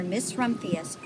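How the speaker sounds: ordinary delivery, nothing marked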